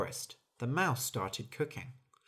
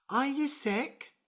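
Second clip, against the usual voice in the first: high, slow squeaky little mouse; small mouse voice; 13 semitones higher than usual. Acted. Mouse asking the tired bird if she is sick. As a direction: ask like a tiny anxious mouse.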